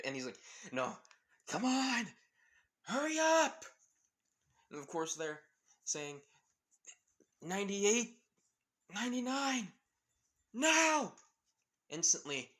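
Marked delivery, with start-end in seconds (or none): 0.7-3.7 high, loud urging; urgent shout. Acted. Kaminari pushes the team, shouting high and loud. Urging during the power surge. 7.4-11.1 countdown rising higher and louder to the last word; rising countdown shout. Acted. Team counts down to the lever, building to a high loud shout.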